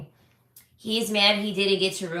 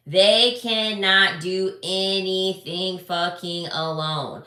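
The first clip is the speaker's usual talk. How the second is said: slow, every word hit apart; drawn out emphasis; much slower than usual. Real, not acted. Mocking men to viewers, slow stressed words. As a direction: spell it out slowly. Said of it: "emphatic slow stress".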